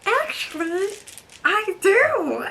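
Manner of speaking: high, playful mock of Special K; guy voice imitation. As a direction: act out his smooth talk lightly mocked. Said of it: "And he was like".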